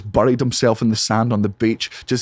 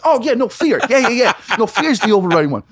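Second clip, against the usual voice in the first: high, urgent comic alarm; suddenly remember the danger, voice shooting up; mock panic; 11 semitones higher, 4 dB louder than usual. Comic remembering he is being chased, high mock panic.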